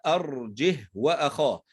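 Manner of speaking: slow recitation, "أرجه" ending on a bare h; unvowelled ha. Shown as a demonstration. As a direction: stop the ha of "أرجه" without lengthening, then "وأخاه". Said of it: "تنطق هاء ساكنة فقط".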